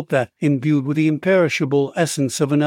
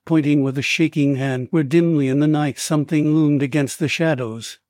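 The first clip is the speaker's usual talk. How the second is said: plain reading voice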